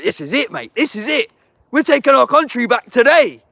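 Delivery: imagined battle cry swelling to a roar; building rally cry. Retold or imitated. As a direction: whip up the crowd until you're shouting.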